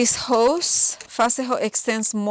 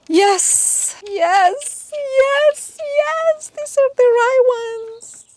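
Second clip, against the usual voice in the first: excited; 13 semitones higher, 4 dB louder, much slower than usual. Real, not acted. Parts fit, delighted.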